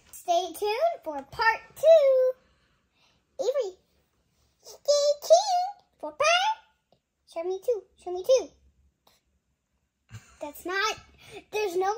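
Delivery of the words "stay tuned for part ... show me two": playful outro. Real, not acted.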